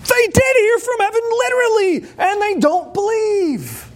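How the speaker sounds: high, insistent exclamation; exasperated. Real, not acted. Preacher stressing that they heard heaven, exasperated and high. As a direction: raise the pitch in disbelief.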